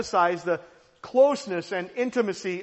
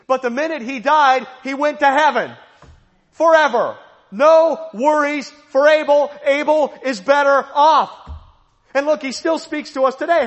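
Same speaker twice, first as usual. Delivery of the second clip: higher and louder, proclaiming joy; rising sermon fervor, almost shouting; triumphant preacher declaring heaven; full-force preaching; 5 semitones higher, 6 dB louder than usual. Acted.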